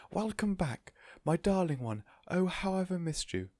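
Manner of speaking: high, bright cry of joy, slowing at the end; joyful cry. A narrator's reading. Missus Silver welcoming her tortoise back, overjoyed. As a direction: gush with delight over a pet. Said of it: "clap her hands with joy and cry".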